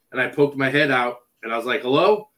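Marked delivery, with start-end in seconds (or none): 1.8-2.3 calling out. Retold or imitated. Calling into dark hallway.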